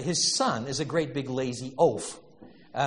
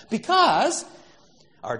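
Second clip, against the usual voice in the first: usual speaking voice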